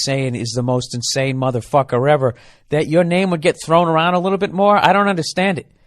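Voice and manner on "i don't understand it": normal talking voice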